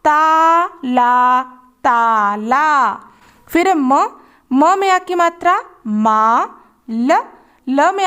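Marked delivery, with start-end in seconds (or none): 0.1-2.9 ताला drawn out very slowly; slow reading demo for children; slow blending. Shown as a demonstration. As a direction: read ताला slowly syllable by syllable. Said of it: "एक साथ मिलाकर पढ़ेंगे".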